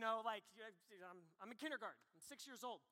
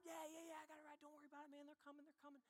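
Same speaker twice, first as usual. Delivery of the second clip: high, fading nervous insistence; hushed bluff; 5 semitones higher, 10 dB quieter, much faster than usual. Retold or imitated. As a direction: quietly fake it, getting softer. Kid bluffing about his ride.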